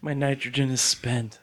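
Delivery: high, loud, hammed-up misery; mock dying plea. Co-host playing a spent nitrogen can, mock pleading. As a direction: beg theatrically through the laughs.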